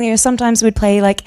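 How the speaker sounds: ordinary delivery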